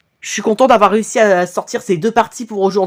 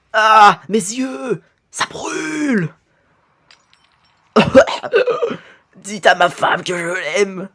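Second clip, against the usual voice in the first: overacted death scene; slower than usual. Character burned, dying words.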